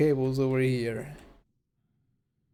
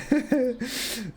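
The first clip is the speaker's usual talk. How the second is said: high laugh tailing off; 12 semitones higher than usual. Real, not acted.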